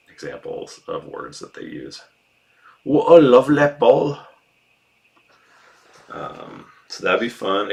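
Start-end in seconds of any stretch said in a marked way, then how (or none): 2.8-4.2 loud, high Mancunian footballer; Mancunian accent. Shown as a demonstration. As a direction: shout it in a broad Manchester accent. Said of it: "doing a Mancunian accent".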